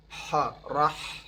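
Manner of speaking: ح and رح drawn out slowly from mid-throat; throaty ha. Shown as a demonstration. Teacher drills students on the throaty ح in رح, slowly. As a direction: breathe the ح from the middle of the throat. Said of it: "از قسمت ما بین گلو".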